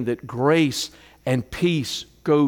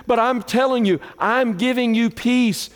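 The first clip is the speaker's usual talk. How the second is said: full-force preaching; 8 semitones higher than usual. Acted. Pastor preaching grace and peace to the church, ringing and high. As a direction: proclaim it to the congregation.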